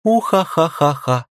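high squealing laugh under tickling; being tickled. Acted. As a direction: laugh helplessly while tickled. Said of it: "Я буду щекотать тебя". (смех от щекотки)

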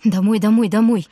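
put-on frightened boy begging loudly to go home; pleading. A narrator's reading. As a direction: beg to go home now.

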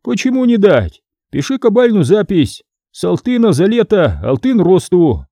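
high, brisk dealer's voice; greedy merchant. Acted. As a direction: offer the loan eagerly. Merchant agrees to lend.

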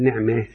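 نعمة with the fatha leaning toward kasra, "ne'me"; نعمة with small imala; imala on ni'ma. Shown as a demonstration. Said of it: "المفروض كلمة نعمة أقول".

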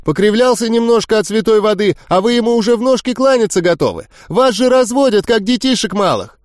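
high, sneering and relentless; young witch mocking the crowd, high and scornful; persistent scorn. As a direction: taunt them like fools. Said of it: "не унималась волшебница".

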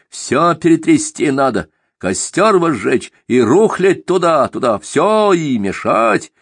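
fiery zealot. Acted. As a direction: preach destruction with fire. Simagin voiced in narration.

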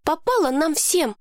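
put-on a girl cutting in to correct him. A narrator's reading. Lowie correcting Mason, high indignant girl voice.

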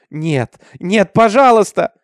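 loud begging that climbs high; screamed plea. A narrator's reading. Minty pleads as they drag her, rising cry. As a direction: beg desperately, building to a cry. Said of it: "кричала она".

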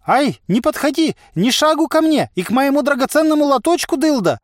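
loud, high-pitched alarmed yelp; frantic high cry; panicked warning. A narrator's reading. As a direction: shriek to keep a threat away. Merchant fends off giant from stall.

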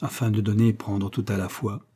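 plain reading voice

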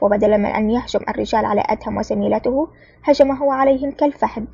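ordinary delivery, nothing marked

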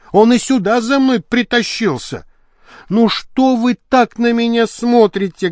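high-pitched, exasperated woman's tirade; indignant actress. A narrator's reading. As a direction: rail theatrically about a hated husband. Актриса возмущённо объясняется про мужа. (высокий голос возмущённой актрисы)

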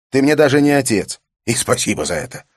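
high, loud furious outburst; explosive rage. Acted. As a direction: spit it out in fury. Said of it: "Его лицо исказилось от ярости".

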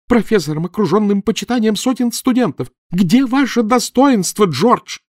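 high, loud indignant shouting; angry tirade. A narrator's reading. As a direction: shame him at full voice. Wife keeps shouting at husband. (жена громко стыдит мужа)

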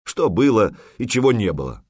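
ordinary delivery

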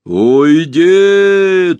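slow, dumb booming drone; dull drawn-out monster voice. A narrator's reading. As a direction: drag it out dimly like a beast. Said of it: "тупо протянуло".

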